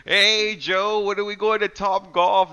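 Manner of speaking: high, slow, chirpy greeting; Mark impression. Imitating Mark greeting a friend in chat, high and drawn out. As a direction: drag out a cheery hello. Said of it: "Mark pops up exactly like that".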